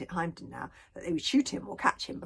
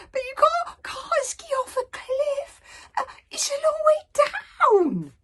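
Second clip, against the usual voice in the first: much higher, wailing dragon scream; 7 dB louder, slower than usual. A narrator's reading. Toothless pleading with Hiccup, screaming. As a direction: scream it in panic. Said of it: "screamed Toothless".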